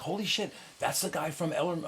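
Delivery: high-pitched, hushed imitation of amazement; amazed exclamation. Friend spots a tycoon's yacht through binoculars, amazed. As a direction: imitate a friend's excited discovery.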